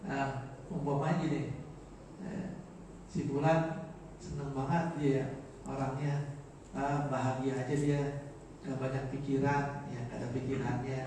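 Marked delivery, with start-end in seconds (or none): 3.1-11.0 positive, carefree intonation. Shown as a demonstration. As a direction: say it lightly as praise for an untroubled person. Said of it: "tergantung intonasi".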